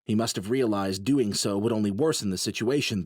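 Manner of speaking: ordinary delivery, nothing marked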